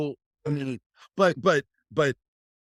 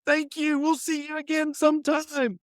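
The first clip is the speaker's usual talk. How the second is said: rock star farewell; 3 dB louder than usual. Pretend. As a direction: thank the crowd like a rock star. Mock rock star concert farewell.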